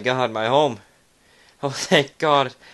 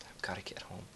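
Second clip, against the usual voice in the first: breathy hushed whisper; 4 semitones lower, 18 dB quieter, faster than usual. Acted. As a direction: whisper it to yourself. Repeated line, whispered.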